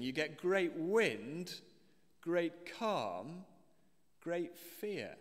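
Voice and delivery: each "great" hit high and slow; stress on great. Shown as a demonstration. Preacher driving home the repeated word, slow stress on great. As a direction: punch "great" in "great wind, great calm, great fear". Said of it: "it is interesting".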